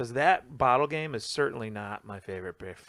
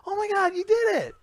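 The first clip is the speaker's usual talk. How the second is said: put-on squealing girlfriend voice, very high and louder; mock delighted girlfriend; girlfriend gushing; 10 dB louder than usual. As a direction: girlfriend amazed at her boyfriend's win. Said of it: "she turns around and was like".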